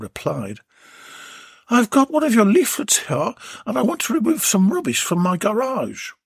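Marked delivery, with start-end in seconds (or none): 1.7-6.1 higher, polite woman on the phone; lightly voiced lady calling a tradesman; a hint of a prim caller; Belinda's voice.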